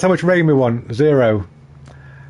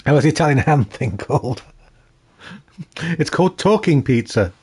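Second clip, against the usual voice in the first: ordinary talk, nothing marked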